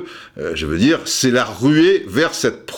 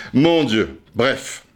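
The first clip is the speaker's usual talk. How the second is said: normal talking voice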